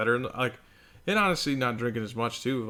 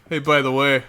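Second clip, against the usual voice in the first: sarcastic; 2 semitones higher, 7 dB louder than usual. Retold or imitated. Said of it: "like, sarcastically".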